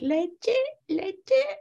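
put-on high crying child voice; toddler whine. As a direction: whine it like a hungry baby. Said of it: "he was all like".

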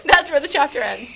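ordinary talk

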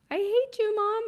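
high mock wail of a small child; toddler tantrum. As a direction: mimic a furious three-year-old. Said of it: "so when he says that".

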